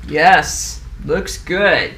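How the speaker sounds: a hint of a pompous old engineer nodding along; lightly voiced self-satisfied approval; mock thoughtful gentleman. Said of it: "thoughtful gentlemen of their time".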